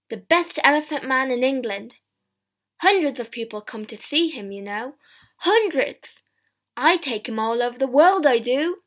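loud showman's patter, proud and emphatic; boastful shopkeeper. A narrator's reading. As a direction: boast loudly to a customer. Shopkeeper boasts about his exhibit.

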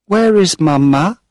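put-on high, loud child's call; a little girl calling out eagerly for her mother; crying out. A narrator's reading. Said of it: "she cried".

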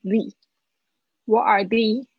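"the" said as "thee"; teacher's model of "thee" for "the"; "thee" before a vowel. Shown as a demonstration. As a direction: say "What are the" ending in a long "thee". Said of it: "刚才有没有发现我是讲the".

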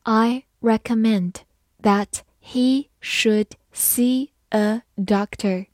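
slow, word-by-word model reading; teacher's slow read-along, each word separate. Shown as a demonstration. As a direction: read it slowly, pausing between the words. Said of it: "慢慢来读".

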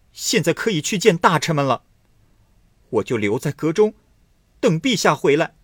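loud, high furious scolding; furious outburst. Acted. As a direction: scold the emperor in a rage. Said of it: "太真大发脾气着说". (大发脾气地高声训斥)

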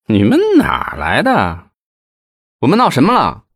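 suspicious, rising to a high challenge; wary question. A narrator's reading. Policemen wary of the strangers, suspicious challenge. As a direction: size them up warily, then push back. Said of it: "他们警觉地问".

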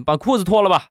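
put-on high-pitched old doctor; 老大夫. Old doctor instructs patient. (老大夫抬高嗓音吩咐)

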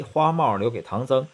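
ordinary delivery, nothing marked

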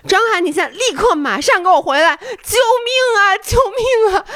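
rising to a high cry of 救命啊; plead, then cry out for help; retold plea for help. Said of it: "我当时说的是". (对张翰求救，越喊越高)

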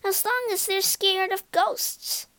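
high-pitched eagerness; acted eager excitement. A narrator's reading. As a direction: jump in keenly, pitch well up. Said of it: "replied Darcy eagerly".

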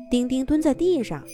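ordinary delivery

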